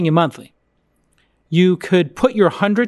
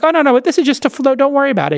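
much higher, fast, breezy mock sales rep; mimicking the salesperson; 9 semitones higher, faster than usual. Host imitating the lender brushing off concerns, mock breezy salesman. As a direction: brush off the worry like a slick salesman. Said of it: "And they're like".

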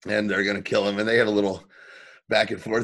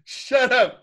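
mock dad insult; 9 semitones higher, 4 dB louder, slower than usual.

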